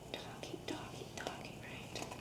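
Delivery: gossipy hushed whisper. Acted. Whispering about gossiping girls.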